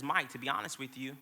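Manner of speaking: soft, quiet and sincere; a wife's soft reply; tender wife's answer. Retold or imitated. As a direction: say it gently like a loving wife. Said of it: "she says".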